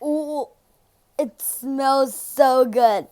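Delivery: slow, loud delight. Real, not acted. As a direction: savor it out loud. Sniffing a gummy.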